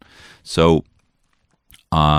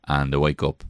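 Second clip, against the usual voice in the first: ordinary delivery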